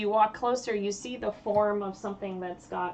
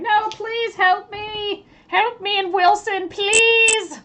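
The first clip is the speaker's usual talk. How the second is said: high, loud, drawn-out pleading; screaming bloody murder; 12 semitones higher, 8 dB louder, slower than usual. Acted. Kobold in a monster's grip screams for help, shrieking. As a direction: scream for rescue, stretching the last plea. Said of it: "is just screaming out in bloody murder".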